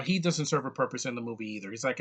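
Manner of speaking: normal talking voice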